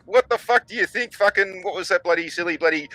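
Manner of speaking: indignant retort. Retold or imitated. Retells indignant retort to Grok.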